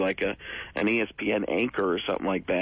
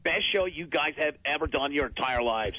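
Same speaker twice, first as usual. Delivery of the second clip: lightly voiced sports-radio host, clipped and cocky; Jim Rome impression; 3 semitones higher than usual. Asked for his Jim Rome, he plays the radio host. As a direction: talk like a smug radio host. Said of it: "what your Jim Rome sounds like".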